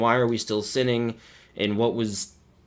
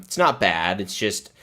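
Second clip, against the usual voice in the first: usual speaking voice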